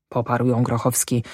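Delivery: ordinary delivery, nothing marked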